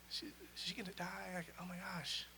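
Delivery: retold crying. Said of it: "I'm, like, crying. I'm like a baby".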